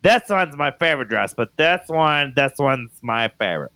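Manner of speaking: mimicking a child's show-and-tell; little daughter's presentation. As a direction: present it like a little kid, drawing out the picks. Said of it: "she's got a good presentation".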